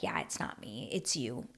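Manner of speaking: low, very soft retold confession; hushed, tender. Daisy conceding to Kelsey at the car, hushed and tender. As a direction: drop right down, almost to a breath. Retells tender moment between two rivals.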